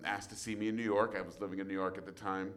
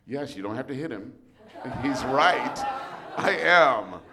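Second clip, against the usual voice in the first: loud laughing reassurance; 4 semitones higher, 10 dB louder than usual. Retold or imitated. Reassuring kid in theme park.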